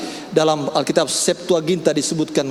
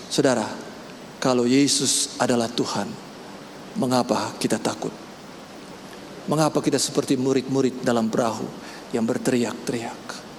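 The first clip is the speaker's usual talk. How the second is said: quiet pleading; 4 semitones lower, 6 dB quieter than usual. Acted. Soft pleading to congregation.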